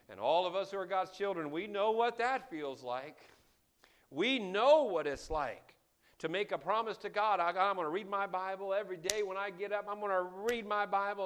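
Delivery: loud, high, rising sermon climax; fiery preacher hammering the sin home; full-force preaching. Acted. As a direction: preach it hard and build.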